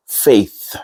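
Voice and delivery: "faith" ending on a light voiceless th; clear th. Shown as a demonstration. Teacher demonstrating the first syllable, clear th in "faith". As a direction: finish "faith" with tongue between the teeth. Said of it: "your tongue has to be between".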